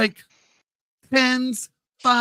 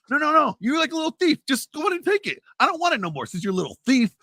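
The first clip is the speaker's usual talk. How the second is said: mocking scolding; 10 semitones higher than usual. Dad berating son for stealing.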